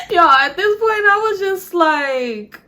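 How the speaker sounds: high squeal, near-crying with laughter; laughing disbelief. Real, not acted. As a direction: squeak the words out through laughter. Still laughing in disbelief afterwards.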